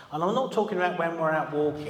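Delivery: ordinary delivery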